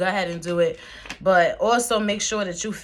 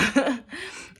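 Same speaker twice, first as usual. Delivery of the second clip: high giggle; 8 semitones higher than usual. Real, not acted. Laughs after greeting spirit.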